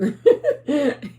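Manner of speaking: high, loud burst of laughing; bursting into laughter. Real, not acted. Vlogger about to tell of her ex's call, bursting into laughter. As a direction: crack up before the story.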